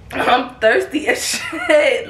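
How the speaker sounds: high, drawn-out words breaking into laughter; can't keep a straight face; laughing. Real, not acted. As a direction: start cracking up mid-sentence. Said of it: "And I'm just laughing".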